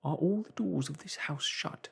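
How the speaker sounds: scared Marvel whispering; frightened whisper. Acted. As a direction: whisper from hiding. Marvel asks fearfully.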